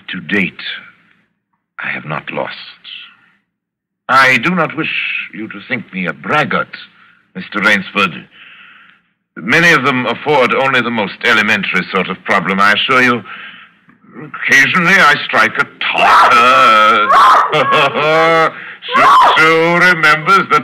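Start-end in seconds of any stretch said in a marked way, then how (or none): 0.1-2.6 sly whisper. Acted. Villain boasting of his record. 14.2-17.1 gleeful boast. Acted. Villain boasting, rising into laughter. 17.1-18.8 a big, crying cackle of delight; booming villain's laugh; loud gleeful laugh. Acted. Villain recalls the Tartar.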